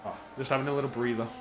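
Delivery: ordinary talk, nothing marked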